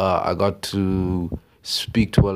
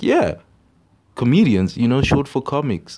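mock clueless friend, pitched higher; a lightly voiced naive friend, higher; 6 semitones higher, 4 dB louder than usual. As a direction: play a clueless kid sure he's right. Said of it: "He's like".

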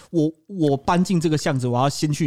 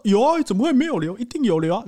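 mock insistent son; 8 semitones higher, 3 dB louder than usual.

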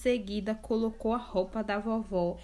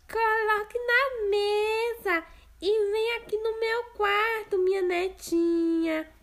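higher fake grandma voice; wolf disguising voice; 12 semitones higher, 4 dB louder than usual. Wolf posing as grandma, disguised voice. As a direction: pass yourself off as grandma.

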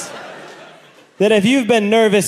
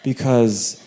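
timid nervous request; 4 semitones lower, 4 dB quieter, slower than usual. Retold or imitated. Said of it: "And I said".

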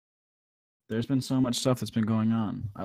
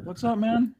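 hyped host greeting; 13 semitones higher, 3 dB louder than usual. Acted. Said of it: "restarting the intro hyped".

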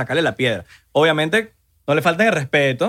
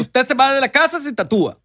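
high scolding mother, higher and firm; mock stern mom; 9 semitones higher than usual. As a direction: scold him like an angry mom. Voicing mom in acted quarrel.

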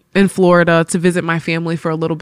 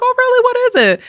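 much higher, louder delighted surprise; surprised mom; 4 dB louder, faster than usual. Retold or imitated. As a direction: gasp it out like a surprised mother.

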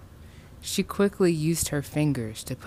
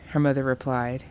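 usual speaking voice

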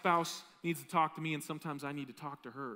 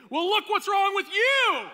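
much higher, much louder, slow defensive outburst; defensive retort; 12 dB louder, slower than usual. Retold or imitated. Defensive person lashing back at a critic, loud and slow. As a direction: throw it back at your accuser. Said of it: "They say".

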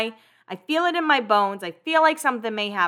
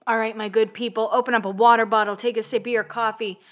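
ordinary talk

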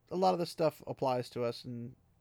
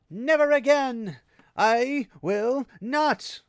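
much higher, louder, slowed defiant shout; 11 semitones higher, 9 dB louder, much slower than usual. A narrator's reading. Fain shouting defiance at the ceiling, loud and slow. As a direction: hurl it at the ceiling. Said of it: "he shouted at the ceiling".

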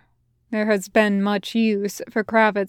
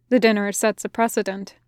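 ordinary delivery, nothing marked

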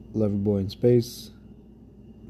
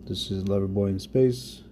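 usual speaking voice

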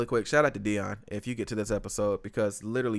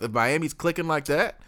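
usual speaking voice

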